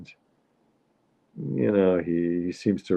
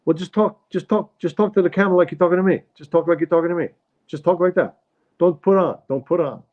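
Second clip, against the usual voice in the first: high-pitched, insistent husband impression; critical New York father; 10 semitones higher than usual. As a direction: nag her over and over in a New York accent. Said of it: "his father is criticizing the mother".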